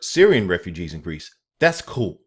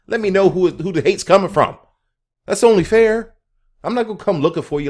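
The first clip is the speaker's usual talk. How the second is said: loud and high; 10 semitones higher, 7 dB louder than usual. Acted. Challenging haters.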